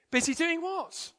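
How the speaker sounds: high-pitched, incredulous question; acted boss, pitch jumping right up; puzzled boss. A narrator's reading. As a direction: ask in baffled disbelief.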